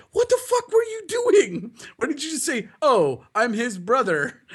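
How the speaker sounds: high, mock outraged yell at friend. Yelling at friend after he ran off, outraged. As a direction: shriek it out in disbelief.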